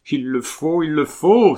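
high cry building to loud desperation; despairing cry. A narrator's reading. Colomban insists she marry Camille, despairing cry. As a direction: cry it out in despair, harder the second time. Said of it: "avec l'accent du désespoir".

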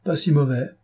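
a hint of sly mockery; mocking air. A narrator's reading. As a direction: needle the comrade with a smirk. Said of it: "fit Pierre d'un air narquois".

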